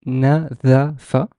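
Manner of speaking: nadafa drawn out syllable by syllable; slow right down on nadafa, final syllable rising; teacher spelling out na-da-fa by sound; slow clear pronunciation. Shown as a demonstration. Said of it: "see how the letter is pronounced".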